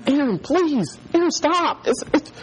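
high-pitched pleading mock panic; freaking out. Five-year-old begging his brother to stop, freaking out. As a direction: beg like a panicking little kid. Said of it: "he starts freaking out".

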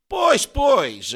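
high, loud eager agreement; relieved. A narrator's reading. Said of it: "igualmente aliviado".

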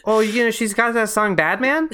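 high Minnesota mom, questioning lift; clueless mom. Pretend. Mom chatting to her kid about pop, put-on mom voice. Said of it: "doing this accent now for a mom".

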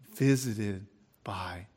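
hushed, slow, breathless whisper; awed whisper. Acted. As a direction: whisper it in disbelief. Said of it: "said the little one".

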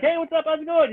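high-pitched friendly greeting; super nice. Retold or imitated. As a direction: imitate an over-friendly hello. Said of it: "super nice".

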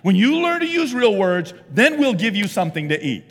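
hammed-up strict parent laying down rules; mock stern parent. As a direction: lay down the law in mock sternness. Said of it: "I say".

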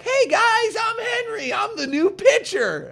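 put-on very high, loud childish voice; mock eager kid. Twelve-year-old greeting big league players, high mock kid voice. Kid entering locker room.

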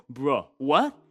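a hint of a baffled teen going bruh; teen son. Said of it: "he would hear that and go".

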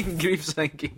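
giggling through the words; laughing. Real, not acted. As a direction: laugh the pun out. Repeats pun laughing.

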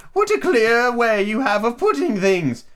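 put-on very high, slow, prim old lady; Duchess voice. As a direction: hold the Duchess's high sing-song. Duchess voiced in read story.

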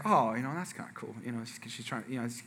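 very soft, low inner monologue; quiet, low musing to himself; musing aside. Retold or imitated. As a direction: think it aloud under your breath. His quiet inner remark, retold.

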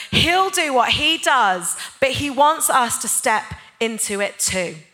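fervent sermon climax; preaching. Acted. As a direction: rouse the congregation. Preaching builds up.

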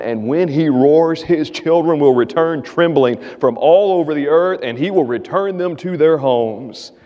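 ordinary delivery

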